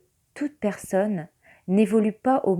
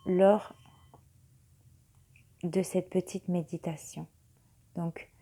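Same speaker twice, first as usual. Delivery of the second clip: right in your ear. Acted. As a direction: guide them gently into calm. Leads a meditation. (voix douce de méditation)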